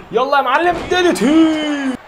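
high, loud and very slow drawn-out yell; hype shout. Real, not acted. Gamer hyping his move at the screen.

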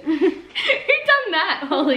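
high, slow, laughing out the question; real giggle pulling every word up and out. Real, not acted. Teasing the friend Holly for her question, laughing.

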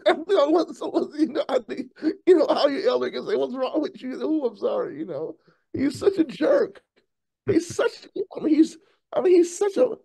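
high-pitched talk broken by laughter; laughing hard. Real, not acted. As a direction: squeal the words out through laughter. Laughing hard while mocking Blinken.

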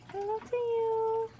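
hello to you squeaked up high and thin; squeaky high. Acted. Greeting the doll in a squeaky high voice.

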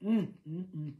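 ordinary talk, nothing marked

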